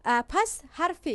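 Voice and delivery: ordinary talk, nothing marked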